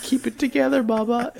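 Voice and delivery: high, wobbly self-encouragement; mock tearful pep talk. Shop owner urges his wife to stay strong, high mock tearful voice. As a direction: tell yourself to hold it together, high and gushy. Ramen owners comfort each other.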